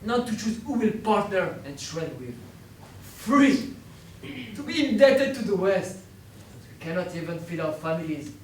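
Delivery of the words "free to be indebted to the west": bitter. Acted. Prisoner's bitter repeated "free.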